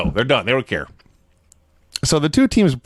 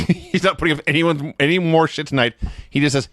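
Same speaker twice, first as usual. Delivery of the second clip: ordinary talk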